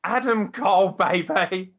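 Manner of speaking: names called out high and loud like an announcer; hype shout. Pretend. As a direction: bellow each name to the crowd.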